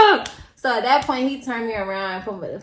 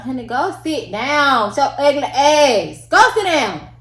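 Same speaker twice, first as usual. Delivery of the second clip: slow, louder mock brush-off with a laugh; petty dismissal; 6 semitones higher, 9 dB louder, slower than usual. Dismissing the yelling girl at the window, slow and laughing. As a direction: drawl it out to shoo someone off. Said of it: "he's just like".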